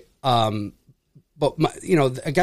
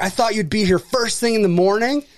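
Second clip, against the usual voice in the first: imitating an indignant complaint, pitched up; mock-angry, higher-pitched protest; retold pissed-off customer; 6 semitones higher than usual. Pissed customer demanding a morning visit, retold in indignant imitation. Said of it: "he was fucking pissed".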